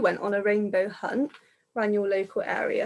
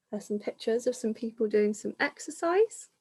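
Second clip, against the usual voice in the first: ordinary delivery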